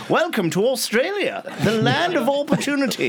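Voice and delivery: put-on high, old-fashioned newsreel announcer; yesteryear announcer voice. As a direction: ham up a vintage documentary narrator. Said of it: "that really yesteryear voice".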